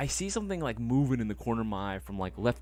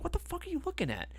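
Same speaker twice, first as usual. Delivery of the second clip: pitched way up, panicked; high squeaky yell; 5 dB quieter than usual. Retold or imitated. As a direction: squeal it at the creature. Yelling at the creature.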